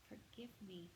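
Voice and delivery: quiet, broken plea; soft plea. A narrator's reading. Father begging his son, soft and quiet. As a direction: beg forgiveness softly.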